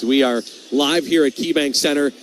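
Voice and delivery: ordinary talk, nothing marked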